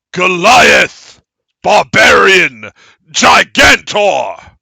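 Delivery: very loud, high, slow shouted announcement; booming statues. Acted. Giant statues welcoming the barbarian to the trial, booming. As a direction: boom every name like giant stone statues. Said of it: "voices booming".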